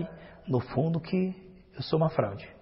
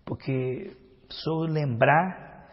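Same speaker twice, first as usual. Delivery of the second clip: ordinary delivery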